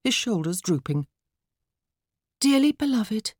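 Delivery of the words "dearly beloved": put-on high girlish solemn address; best funeral voice. A narrator's reading. Daughter opening a plant's funeral, funeral voice. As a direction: intone it like a child officiant. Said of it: "in her best funeral voice".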